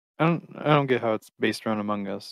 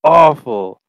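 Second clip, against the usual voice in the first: louder, slowed mock outrage; mock outraged complaint; 2 semitones higher, 12 dB louder, slower than usual. Retelling a friend's rant about an Irish YouTuber, loud and slow. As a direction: blast it like a disgusted friend. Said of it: "He's like".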